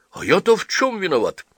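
defensive husband. A narrator's reading.

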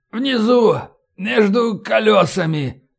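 high, strained, laboured call; wounded man's strained cry; short of breath. A narrator's reading. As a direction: call out while fighting for air. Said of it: "Роллинсону не хватает воздуха".

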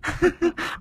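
high, eerie cackle; shrill mad laughter; possessed cackle. A narrator's reading. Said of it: "Cô cười khanh khách".

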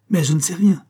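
high, loud exclamation; loud protest. A narrator's reading. Director answering the police chief, protesting loudly. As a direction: proclaim ignorance emphatically. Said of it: "clama Verdier".

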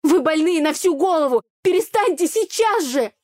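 yelling. A narrator's reading. Heroine yells at the brothers to stop fighting. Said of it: "орала я".

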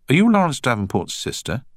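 a touch of disbelieving surprise; half-asking, unable to quite believe it; mildly incredulous question; disbelief. Acted. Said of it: "said Danny in disbelief".

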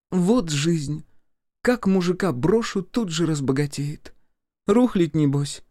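high-pitched woman's voice, lamenting then hopeful; hopeful ex-wife. A narrator's reading. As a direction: pitch it well up, ending on a hopeful question. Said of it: "спросила она с надеждой". (высоким женским голосом, с надеждой)

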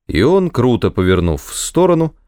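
ordinary delivery

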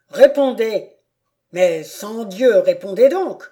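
loud, demanding questions building to a shout; an enraged father demands answers; furious interrogation. Acted. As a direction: hammer him with questions until he answers. Count interrogating his son furiously.

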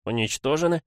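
put-on doubtful, high-pitched boy's question; note of doubt. A narrator's reading. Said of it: "спросил он с ноткой сомнения". (с ноткой сомнения)

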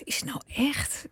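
whispered thought. Retold or imitated. Host voices own thought.

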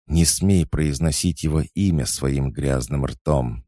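growled anger. A narrator's reading. Empress warning her brother off a name, growled in anger. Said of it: "прорычала императрица".

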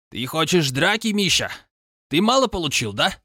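high taunting threat; threatening. A narrator's reading. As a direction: dare him to fight. Roommate threatening a fight. (угрожающая насмешка)

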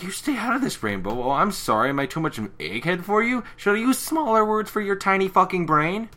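mock-haughty put-down; condescending rant. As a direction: talk down to her. Twilight insulting Rainbow.

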